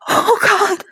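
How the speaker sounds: high, loud distressed cry; distressed exclamation. A narrator's reading. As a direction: cry out, overwhelmed. Character overwhelmed after the ordeal.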